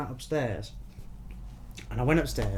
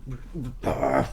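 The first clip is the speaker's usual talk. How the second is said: hushed, drawn-down demon voice; demonic Satan. Retold or imitated. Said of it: "Like, proper Satan saying".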